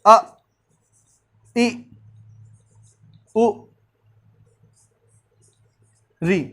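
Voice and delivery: each short vowel said alone with long pauses; अ, इ, उ, री spoken slowly one by one for notes; vowel dictation. Shown as a demonstration. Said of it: "note कर लेंगे". (ह्रस्व स्वर धीरे-धीरे अलग-अलग बोलें)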